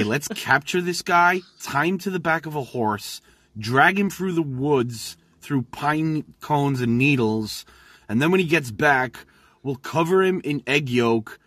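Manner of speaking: put-on American accent. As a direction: ham it up as panicked American villagers. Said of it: "Pete, was that you doing an American accent?".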